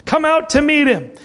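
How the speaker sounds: high-pitched summons; acted shout. A narrator's reading. Midnight cry summons virgins to the bridegroom, high call. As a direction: call them out as a herald would. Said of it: "there was a shout".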